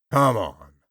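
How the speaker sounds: lightly voiced gruff man; coaxing tough guy. A narrator's reading. George's low rough voice.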